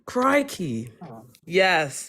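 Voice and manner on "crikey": Australian accent. Shown as a demonstration. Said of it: "Can you say crikey, mate?".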